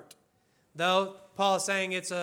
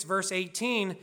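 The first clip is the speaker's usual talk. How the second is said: usual speaking voice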